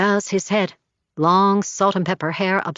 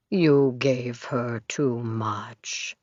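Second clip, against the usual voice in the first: very slow, low, stretched out; drugged dream voice; slow distorted voice; 9 semitones lower, 6 dB quieter, much slower than usual. A narrator's reading. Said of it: "pulled long and slow like taffy".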